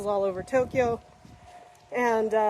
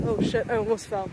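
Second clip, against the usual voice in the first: ordinary talk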